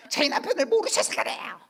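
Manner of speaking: wife protesting to the pastor about her husband, high and loud; voice up an octave. Retold or imitated. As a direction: squeal it out like an upset parishioner. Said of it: "목소리도 한 톤이 올라가".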